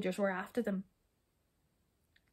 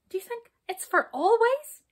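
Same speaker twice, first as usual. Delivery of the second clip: anxious; 13 semitones higher, 8 dB louder than usual. Acted. Brownie anxious question.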